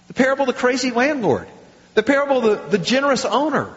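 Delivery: emphatic renaming. Real, not acted.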